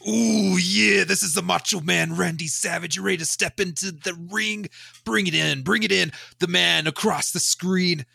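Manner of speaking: high, far-off raspy wrestler growl; Macho Man Randy Savage. Pretend. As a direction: pump up the crowd like a hammy pro wrestler. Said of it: "I can actually do the Macho Man voice".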